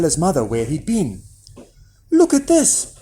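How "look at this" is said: put-on high, excited boy's command; excited boy showing off. A narrator's reading. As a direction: order it eagerly, pitched right up. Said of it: "he commanded".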